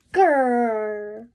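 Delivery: loud playful growl; a big loud mock dinosaur growl. Growl at end.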